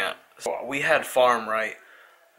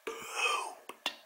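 low, drained, almost voiceless mumble; barely awake, running on empty; worn-out tired; 12 semitones lower, 10 dB quieter than usual. Real, not acted. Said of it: "if you can not tell".

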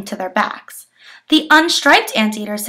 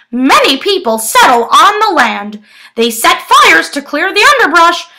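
higher, louder bird voice; squawking toucan; 6 semitones higher, 5 dB louder than usual. Acted. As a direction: warn like an alarmed toucan. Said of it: "squawked the toucan".

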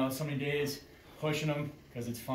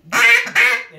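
feeding duck call; 17 dB louder than usual. Shown as a demonstration.

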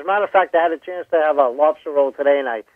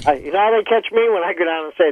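ordinary talk, nothing marked

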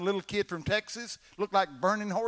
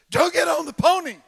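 put-on frightened boy; 12 semitones higher, 13 dB louder than usual. Boy answers brother, frightened.

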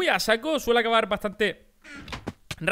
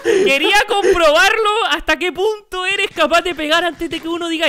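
loud laughing cheater call; laughing accusation; 9 semitones higher, 7 dB louder, slower than usual. Real, not acted. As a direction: shout it while laughing. (acusación entre risas)

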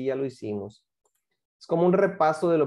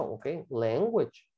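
slow model word; 5 semitones lower, 5 dB quieter, slower than usual. Shown as a demonstration. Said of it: "¿Y cómo se dice eso?".

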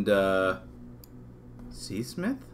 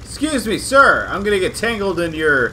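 calling out; 7 semitones higher, 7 dB louder than usual. Real, not acted. Calling out to a stranger.

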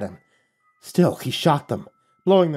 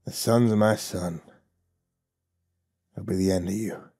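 low, quiet dying growl; a lightly voiced old man growling low; preacher's last low curse, softer; growled threat; 4 semitones lower, 5 dB quieter than usual. A narrator's reading. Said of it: "he growled at me".

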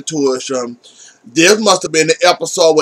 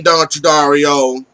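ordinary talk

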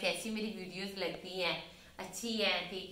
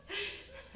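airy giggle; 12 semitones higher, 8 dB quieter than usual. Real, not acted. Giggles about the biryani.

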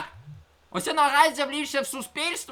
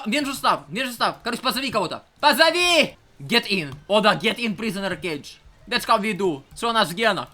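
ordinary delivery

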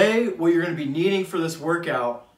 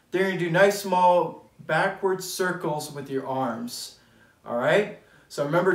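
ordinary delivery

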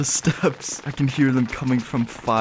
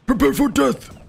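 much higher, hammed-up war cry; mock battle cry; 12 semitones higher, 3 dB louder than usual. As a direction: bellow it like a cartoon knight.

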